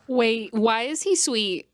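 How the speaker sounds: ordinary talk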